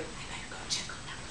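quick whisper to himself; whispered thought. Acted. Bridegroom deciding to go down, quick whisper. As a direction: whisper it fast under the breath.

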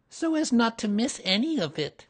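timid little helper chiming in; Piglet voice. A narrator's reading.

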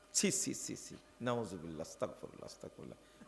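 fading to a breathless whispered astaghfirullah; disgusted murmur. Real, not acted. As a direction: mutter it away in disgust, dropping to a whisper. Preacher recoils from an insult.

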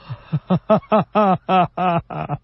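long high anguished wail; Confucius wailing. Acted. Old teacher breaking down over his disciple, wailing. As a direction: let out a broken, grieving howl. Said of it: "孔子不禁失聲痛哭".

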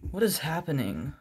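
ordinary talk

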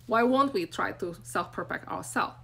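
ordinary talk

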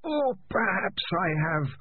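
creeping sinister whisper in the mind; alien voice. Acted. Alien voice continues.

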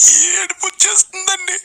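high-pitched fake wailing; mock crying. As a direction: wail it like an overcome devotee, badly faked. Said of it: "అదే ఎందుకమ్మా?".

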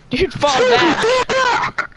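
high, slow, drawn-out yell; shouting orders over battle. Real, not acted. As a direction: stretch it out, yelling at him. Ordering teammate to retreat.

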